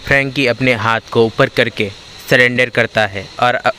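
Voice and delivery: ordinary delivery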